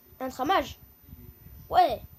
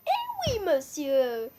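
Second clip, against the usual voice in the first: triumphant catchphrase; much slower than usual. Pretend. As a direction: gloat like you called it. Catchphrase when guess is right.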